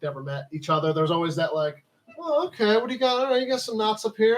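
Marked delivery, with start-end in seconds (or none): 2.2-4.4 lightly acted nosy greeter, higher pitch; mock curious scout. Scouts meeting for the first time, mock curious. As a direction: imitate a scout sizing up another's badges. Imitating scouts sizing each other up.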